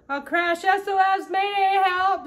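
very high, very loud panicked yells; panicked cry for help. Acted. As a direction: scream it as you fall. Gus falling, calling for help.

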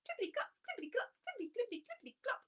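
high fast clippity clop; high quick hoof chant. Acted. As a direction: trot it out light and quick. Hooves of wee goat.

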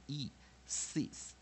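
Y in "sis" said as a short i; short vowel. Shown as a demonstration. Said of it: "short vowel， 它就是念E".

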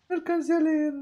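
No alcancé a leer jumped way up high in a silly squeak; mock squeaky voice. Streamer jokingly squeaks No alcancé a leer up high.

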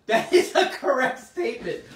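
said through big laughter; laughing. Real, not acted. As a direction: laugh while you confirm it. Laughing at chat comments.

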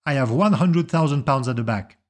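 called out loudly across a room; loud bid announcement. Acted. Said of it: "announce his last bid loudly".

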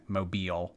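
"mobile" said MOH-beel. Shown as a demonstration. Host apologizes for the slip, "mobile" as MOH-beel. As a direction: give "mobile" a long ee ending. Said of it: "It's pronounced mobile".

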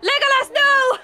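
high, loud warning yell; alarmed yell. Real, not acted. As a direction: shout a panicked warning. Warning teammate of danger.

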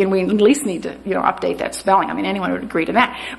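ordinary talk

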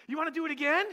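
eager ask. Retold or imitated. Son asks after the ride.

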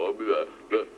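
ordinary talk

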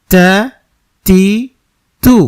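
তা তি তু each short with air. Shown as a demonstration. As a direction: run the three vowels on ত with a puff. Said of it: "জবর জের পেশ দেই". (জবর জের পেশ দিয়ে তা তি তু)

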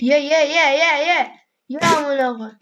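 real eager urging. Real, not acted. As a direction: egg the animal on to eat. Feeding animal, urging.

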